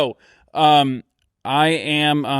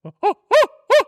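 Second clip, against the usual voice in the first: put-on baby squeal; 4 dB louder than usual.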